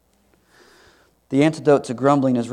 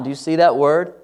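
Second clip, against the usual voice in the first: usual speaking voice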